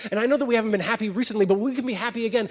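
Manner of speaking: high, loud, fast wheedling plea; a clingy voice begging to go home; pleading lizard. Acted. Lizard begs the ghost to go back home, high and fast.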